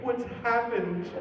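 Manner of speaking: high, drawn-out wail of dismay; hammed-up, high-pitched slow lament; mock dismay. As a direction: act out horror at your own reflection, slowly. Mimics dismay at the mirror.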